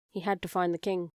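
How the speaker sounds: plain reading voice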